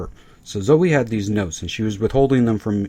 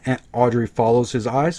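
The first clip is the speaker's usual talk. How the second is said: ordinary delivery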